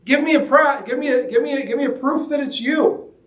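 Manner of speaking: high, pleading, halting Peter; voicing Peter. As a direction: beg for proof, stumbling over the words. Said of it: "he tells Jesus".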